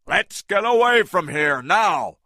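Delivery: high, loud urgent call; shouted. A narrator's reading. Engelhorn fleeing Kong, a loud urgent shout. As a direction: yell it to get moving. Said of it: "he shouted".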